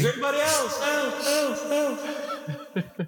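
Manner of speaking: high call fading like an echo. Pretend. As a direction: call out and let it echo away.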